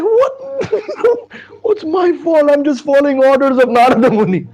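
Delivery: very high, loud frightened protest; alarmed, wailing self-defence; acted panic. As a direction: plead innocence in a hammed-up squeal. King learns he will be killed.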